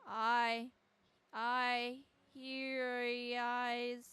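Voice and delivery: loud, long drawn-out wail; sustained cry of distress; acted scream. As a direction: stretch the words into a loud cry. Acts victim crying out.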